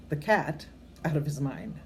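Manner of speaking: plain reading voice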